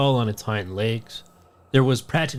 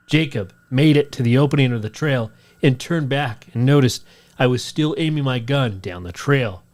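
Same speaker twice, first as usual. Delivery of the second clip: ordinary talk, nothing marked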